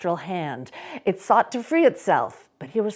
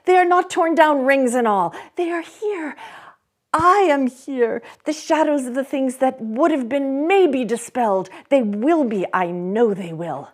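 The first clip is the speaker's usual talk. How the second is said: loud, much higher delighted cry; overjoyed cry; 6 semitones higher, 6 dB louder than usual. Acted. Scrooge clutching his bed curtains, crying out overjoyed. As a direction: shout it with wild relief. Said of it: "cried Scrooge".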